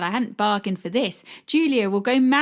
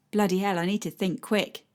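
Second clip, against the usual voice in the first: ordinary delivery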